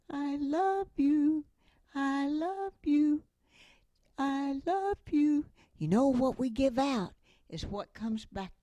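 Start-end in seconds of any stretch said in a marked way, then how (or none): 0.1-5.5 slow, higher echoing call; echo from across the canyon. Retold or imitated. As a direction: let it ring back like an echo, slowly. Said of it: "came the voice".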